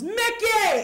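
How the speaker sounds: high, drawn-out yell for his wife; acted father's scream; mock scream. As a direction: holler the name in comic panic, voice shooting up. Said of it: "screams my mother's name on the top of his lungs".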